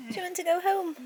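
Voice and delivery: high sweet question to the cat; pet-talk question; high cooing pet voice. Real, not acted.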